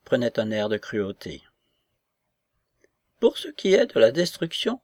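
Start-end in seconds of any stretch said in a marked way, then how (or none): 3.2-4.8 high, emphatic grande dame; agitated lady's pronouncement, pitched up; croaking lady. A narrator's reading. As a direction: launch in with a high, forceful voice. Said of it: "croissa-t-elle devant Razumov".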